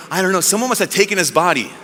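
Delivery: ordinary talk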